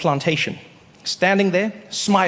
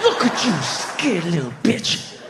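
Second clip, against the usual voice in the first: slow taunting Harriet; slower than usual. Acted.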